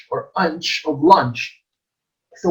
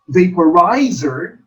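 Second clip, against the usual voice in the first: "vaporizer" as va-po-RI-zer; third-syllable stress; 4 dB louder than usual. Shown as a demonstration. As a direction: punch the RI in "vaporizer". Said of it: "the third syllable is accented".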